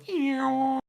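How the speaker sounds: high whining car roof sound; mock mechanical eeeeow; convertible roof noise. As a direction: squeal it high like a motor folding the roof. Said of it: "the roof goes".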